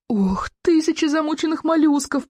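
high, pained wail; groaning dismay.

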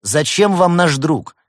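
slow, high, loud demand; loud outraged challenge; indignant shout. A narrator's reading. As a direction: shout the question across at the kidnapper. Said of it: "закричал Улис".

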